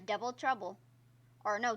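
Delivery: normal talking voice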